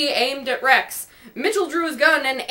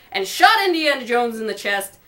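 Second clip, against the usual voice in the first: plain reading voice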